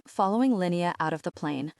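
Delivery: plain reading voice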